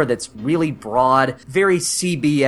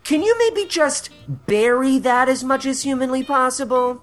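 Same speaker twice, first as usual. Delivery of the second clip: lampoon a director's tactless note, pitch often raised; mocking director; 8 semitones higher than usual. Mockingly voices homophobic director's note.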